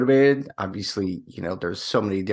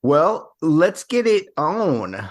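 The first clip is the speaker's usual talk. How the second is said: hammy hype; 7 semitones higher, 5 dB louder, much slower than usual. Acted. Hammy hype-up, slow and high.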